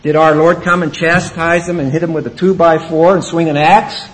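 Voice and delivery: usual speaking voice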